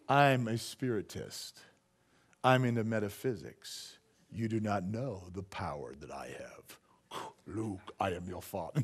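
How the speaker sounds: low, hushed, ominous whisper of a would-be sorcerer; spooky mystic voice. Retold or imitated. Mocking the spiritist at the coffee shop, low eerie whisper. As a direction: play the creepy spiritist, low and breathy. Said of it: "No, no, he didn't do that".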